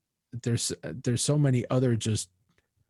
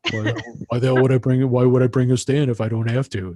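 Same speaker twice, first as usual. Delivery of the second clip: louder, incredulous comeback; gruff retort; 5 dB louder than usual. Retold or imitated. Leonardi telling the singer why he brought no stand, gruff. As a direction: scoff at the idea.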